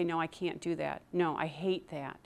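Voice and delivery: understated fearful no; quick refusal. Retold or imitated. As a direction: turn it down in a small voice. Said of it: "you immediately say".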